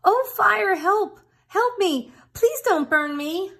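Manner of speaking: high, loud pleading squawk; panicked cry for help. Acted. Rooster in hot water begs the fire, high and panicked. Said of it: "he said".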